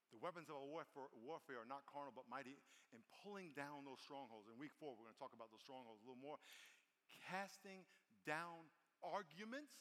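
ordinary delivery

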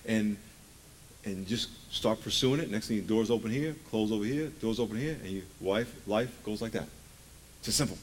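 ordinary talk

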